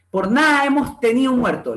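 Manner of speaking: NADA said as NÁ, TENIDO as tenío; dropped D. Acted. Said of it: "la pérdida de la D en nada".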